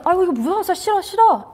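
high cooing sympathy; high comforting parent voice; sympathetic coo. Shown as a demonstration. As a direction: lift the voice high to soothe a child. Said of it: "이렇게 공감 무조건 해줍니다".

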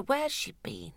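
lightly voiced detective musing; hint of Miss Marple pondering; sleuth voice. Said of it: "put on my Miss Marple".

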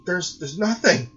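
put-on high, halting, frightened boy; panicked. A narrator's reading. Son writhing in the chair, frightened and stammering. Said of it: "he said, writhing in the chair".

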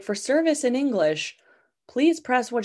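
sing-song prompt of a phone menu; sweet robo-operator reading the menu; polite automated phone lady. Retold or imitated. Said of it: "She's like".

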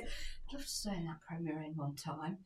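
soft, low retold longing; imitated wistful murmur. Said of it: "She goes".

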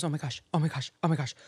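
hushed, repeated mock panic; freaking out. King reacts to his frozen hand, quietly freaking out. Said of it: "J B starts freaking out".

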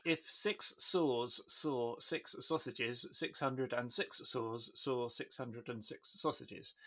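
slow, careful English tongue twister; rapid s and sh drill. Shown as a demonstration. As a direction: spell out "six saws saw six sausages" slowly. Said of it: "which means".

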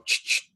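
voiceless "ch-ch" kick sound effect; short hissed mouth noises, imitating a brother; imitated kick noises. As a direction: mimic a silly noise for a goalkeeper's kick. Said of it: "Just odd noises sometimes will come from him".

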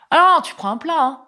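high, pushy mock-David; insistent host. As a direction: insist she orders a main dish. David insiste pour qu'elle commande. (David insiste, voix haute et appuyée)

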